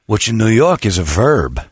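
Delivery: ordinary talk, nothing marked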